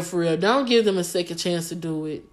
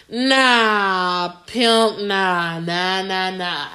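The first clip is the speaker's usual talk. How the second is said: dismissive nah; much slower than usual. Real, not acted. Dismissive refusal.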